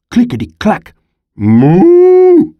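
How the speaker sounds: very high, loud cow moo. A narrator's reading. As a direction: bellow it like a cow. Typewriter and cow sounds.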